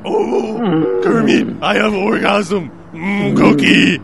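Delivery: high, loud Muppet voice with slow, moaning mmm; Muppet impression. Mocking the soup eater as a high loud Muppet. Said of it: "What is he, a Muppet?".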